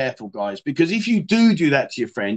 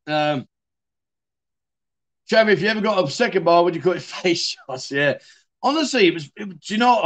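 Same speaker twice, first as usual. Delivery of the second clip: ordinary talk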